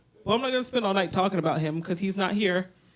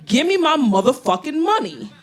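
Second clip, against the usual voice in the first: entitled demand; 6 semitones higher, 5 dB louder, slower than usual. Retold or imitated. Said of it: "I'm gonna be like".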